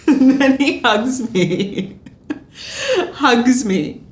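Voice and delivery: laughing loudly through the words; can't stop laughing as you tell it. Real, not acted. Laughing while retelling the hug.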